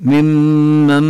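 ممن drawn out very slowly with long nasal doubled mim. Shown as a demonstration. Teacher demonstrating doubled mim very slowly. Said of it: "فنشدد الميم".

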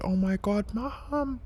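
high, imitated cry for Mom; mock scream. Daughter calling her mother from the stove, mock scream. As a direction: echo the daughter's panicked call lightly. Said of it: "she just screamed".

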